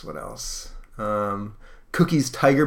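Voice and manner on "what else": whispered to himself; whispering. Real, not acted. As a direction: whisper it under your breath.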